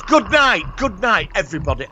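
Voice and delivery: high, cheery sign-off; high-pitched farewell. Retold or imitated. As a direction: sing out a last goodbye. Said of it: "And he goes".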